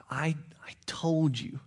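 slow whisper; slow whispered rebuke. Acted. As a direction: breathe it out slowly, disappointed. God confronting Adam and Eve.

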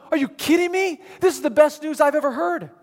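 high-pitched, thrilled disbelief; shocked delight. Acted. Crowd hearing Paul's news of the Messiah, shocked delight. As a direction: react as if stunned by wonderful news. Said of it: "everyone was shocked at this point".

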